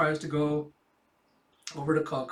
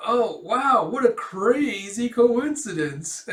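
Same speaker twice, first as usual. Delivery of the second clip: fake surprise; 8 semitones higher, 8 dB louder, much slower than usual. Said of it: "And Frankie's like".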